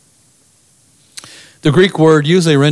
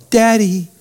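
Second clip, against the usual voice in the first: tender daddy; 7 semitones higher than usual. Shown as a demonstration. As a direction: call him like a child would. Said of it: "think of the intonation".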